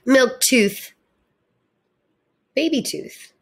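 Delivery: plain reading voice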